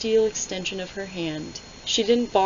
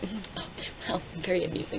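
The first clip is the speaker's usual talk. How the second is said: much softer, fast coy murmur; coy mumble; 11 dB quieter, faster than usual. Retold or imitated. Reviewer voicing a blushing Celaena, softly and coyly. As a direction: murmur it bashfully, like a blushing girl. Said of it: "And she's like".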